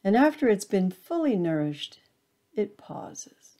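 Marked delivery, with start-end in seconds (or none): none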